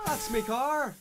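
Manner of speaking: high-pitched mock angry shout; hammed-up angry yell; shouted angrily. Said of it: "shooted Draco angrily".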